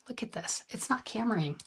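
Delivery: a quiet aside to yourself; muttering at the broken camera; whispered grumble. Real, not acted. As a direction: whisper it, mildly annoyed.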